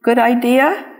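usual speaking voice

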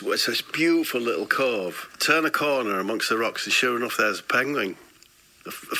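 normal talking voice

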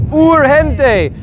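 urgente as "oor-hen-tay"; Spanish urgente with long U and breathed G; swinging U. Shown as a demonstration. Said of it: "we have the U, the swing".

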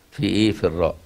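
ordinary talk, nothing marked

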